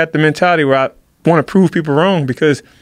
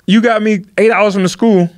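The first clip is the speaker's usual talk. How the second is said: high-pitched, incredulous retelling of a grievance; indignant complaint; 7 semitones higher than usual. Acted. Benched wrestler protesting that his parents drove all that way, indignant complaint. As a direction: plead the unfairness, pitch held high.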